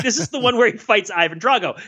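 normal talking voice

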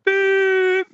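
high sung beep like a tape machine; imitated machine beep. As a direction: squeak a long answering machine beep. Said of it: "Was that eighties enough for you".

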